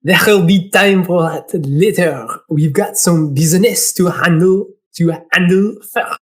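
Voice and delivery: put-on French-accented assistant; French accent, steady pitch. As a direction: speak as a businesslike Frenchman. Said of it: "He's gonna be French".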